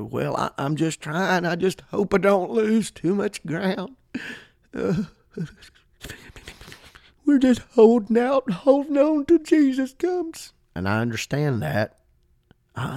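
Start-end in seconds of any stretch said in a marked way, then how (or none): 0.0-10.5 high, strained voice of someone barely coping; mock defeatist. Preacher mimicking believers just holding on, mock defeatist. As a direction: whine it out, climbing ever higher. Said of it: "eleventh hour mentality".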